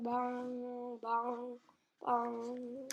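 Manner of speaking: plain reading voice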